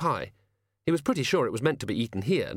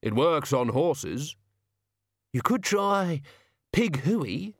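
put-on gruff old servant; 5 semitones higher, much slower than usual. The sacks speak.

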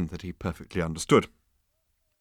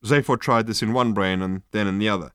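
plain reading voice

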